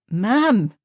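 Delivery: a loud, shocked cry; horrified. A narrator's reading. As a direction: recoil in horror. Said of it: "Jamie was horrified".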